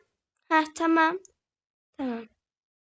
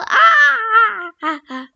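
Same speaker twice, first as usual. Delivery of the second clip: scream; 6 semitones higher, 8 dB louder than usual. Real, not acted. Falls in game, screams.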